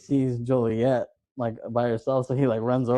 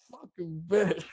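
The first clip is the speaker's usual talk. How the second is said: raised, put-on wounded voice; mock dying curse; 5 semitones higher, 4 dB quieter, slower than usual. Quoting the victim's funny reaction, mock pained curse. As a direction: imitate a bitten man's last curse.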